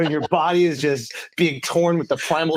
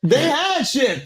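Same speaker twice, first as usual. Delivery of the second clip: laughing; 10 semitones higher, slower than usual. Real, not acted.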